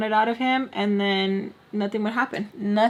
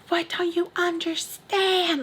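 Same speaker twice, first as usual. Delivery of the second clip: mock-furious high, drawn-out voice; exasperated grandma; 9 semitones higher, slower than usual. Pissed-off grandma to granddaughter who won't lie, exasperated imitation. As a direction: imitate grandma at the end of her patience, stretching each word. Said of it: "she was so pissed off".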